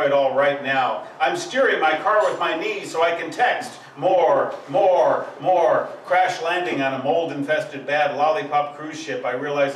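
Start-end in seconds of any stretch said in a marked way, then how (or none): 4.0-5.9 three loud falling calls for more; loud demand. Acted. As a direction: demand it louder each time. Repeated cry of craving.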